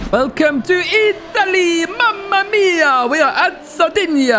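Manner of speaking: high, drawn-out, hammed-up Italian announcer cry; mock Italian accent. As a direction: belt out a cartoonish Italian welcome, stretching the vowels. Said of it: "why I did that accent".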